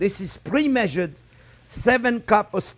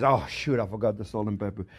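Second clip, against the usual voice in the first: quick, low, softer mock realization; retold dismay; 11 semitones lower, 6 dB quieter than usual. Cook quoting himself forgetting salt, lightly dismayed. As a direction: mutter it like you just caught your own mistake. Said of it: "and then I realize".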